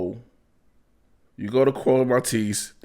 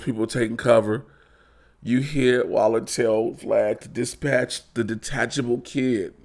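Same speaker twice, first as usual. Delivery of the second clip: usual speaking voice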